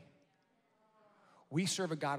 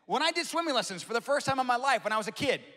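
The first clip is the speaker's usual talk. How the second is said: much higher and louder, ringing out; full-force preaching; 9 semitones higher, 10 dB louder than usual. Acted. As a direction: lift the room with it.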